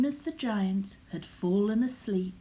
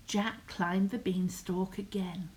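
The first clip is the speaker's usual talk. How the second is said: ordinary delivery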